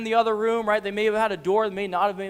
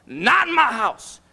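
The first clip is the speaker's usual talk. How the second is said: indignant parent; 5 dB louder than usual. Acted. Parent defending house rules, loud indignant refusal. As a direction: lay down the law like a fed-up parent. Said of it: "I know that as a parent".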